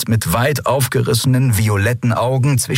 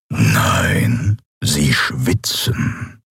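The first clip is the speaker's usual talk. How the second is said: low, very slow imperious voice; menacing emperor; 7 semitones lower, much slower than usual. Acted. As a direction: draw out each word as a looming dark emperor. Kaiser kontert den Spott. (bedrohlicher Kaiser, tief und sehr langsam)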